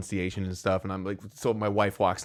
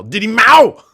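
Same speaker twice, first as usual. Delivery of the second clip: high, very loud shouted outburst; mock yell; 13 semitones higher, 18 dB louder, slower than usual. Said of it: "wanna slap him and yell".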